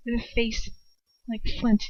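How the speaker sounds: usual speaking voice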